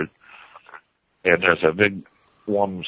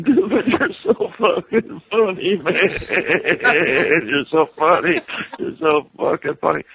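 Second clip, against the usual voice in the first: high, laugh-soaked stoned voice; imitating a giggling friend; 10 semitones higher than usual. Friend face-down in the grass, laughing at the storyteller. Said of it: "He's going".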